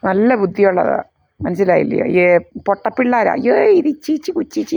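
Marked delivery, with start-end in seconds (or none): 3.4-4.7 put-on high cooing baby-talk voice, much higher. Mother mocking the baby talk used to fool toddlers, high cooing voice. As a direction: coo it like fooling a toddler. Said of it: "എന്നൊക്കെ പറയുമ്പോഴത്തേനെ".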